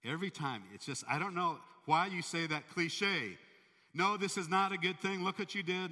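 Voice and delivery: fed-up anger boiling over; building fury. Retold or imitated. King scolding his servant, building from muttering to shouting. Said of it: "the guy goes".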